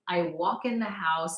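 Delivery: ordinary delivery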